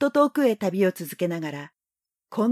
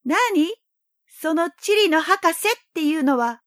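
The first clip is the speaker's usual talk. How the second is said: put-on high, curious little boy; young boy voice; 8 semitones higher than usual. A narrator's reading. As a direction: ask it brightly like a child. Prince asks.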